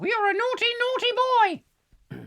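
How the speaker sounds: very high, teasing scold. Retold or imitated. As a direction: wag a finger in falsetto. Mocking the complainer's scolding.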